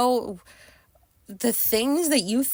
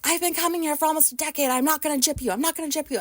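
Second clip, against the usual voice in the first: anxious pleading; 7 semitones higher, faster than usual. Retold or imitated. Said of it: "I was so anxious".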